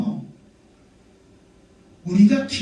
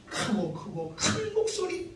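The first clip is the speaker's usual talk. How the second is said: earnest intensity; 8 semitones higher, 11 dB quieter, slower than usual. Shown as a demonstration. Describes fervent prayer voice, drawn out.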